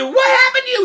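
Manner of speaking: very high, loud screech; shrieking mother. Acted. Mother confronting buttered Jack, shrieking. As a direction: shriek it in hammed-up horror.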